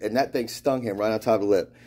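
plain reading voice

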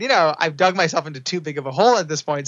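ordinary delivery

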